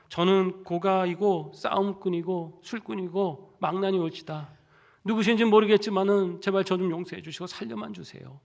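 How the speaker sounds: begging for his life, half sobbing; terrified pleading. Retold or imitated. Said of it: "막 이러면서 엉엉 막 울기". (두려움에 떨며 애원하듯)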